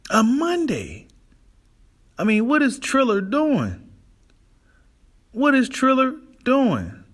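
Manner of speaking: high-pitched, drawn-out disbelief; real exasperation, pitch soaring; incredulous. Real, not acted. Ranting at the Monday scheduling.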